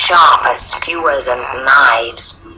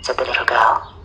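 usual speaking voice

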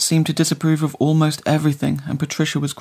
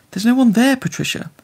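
higher, louder voice for babies and dogs; baby-talk voice; 8 semitones higher, 3 dB louder than usual. A narrator's reading. Companion humoring the blind woman, in baby talk. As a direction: talk down to her like a small child. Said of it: "the voice she reserved for babies and dogs".

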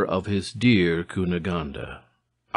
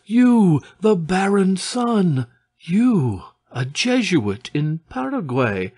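high, loud string of astonished questions; wonderstruck, voice raised and pitched high; incredulous amazement; 9 semitones higher, 5 dB louder than usual. A narrator's reading. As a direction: exclaim each "you" in disbelief. Amazed reunion with man thought slain.

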